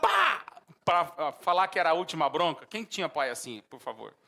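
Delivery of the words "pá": loud, high "pá!"; sudden explosive smack noise; imitated belt snap. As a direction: imitate a folded belt cracking. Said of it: "fazia assim".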